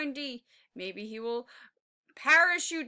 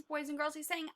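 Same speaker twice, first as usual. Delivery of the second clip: ordinary delivery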